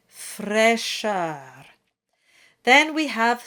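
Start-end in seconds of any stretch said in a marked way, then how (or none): 0.1-1.7 Fraîcheur with open e and a light R. Shown as a demonstration. Teacher models the word for singing. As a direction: sing Fraîcheur with a light final R. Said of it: "light R at the end".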